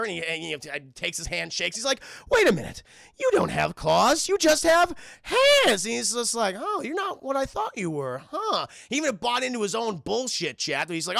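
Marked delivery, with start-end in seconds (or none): 2.3-5.8 loud, slow mock amazement; astonished discovery. Jack's retold startled discovery. 6.6-8.7 higher, musing mock surprise; pitched-up bemused reaction; puzzled wonder. Jack's retold puzzlement.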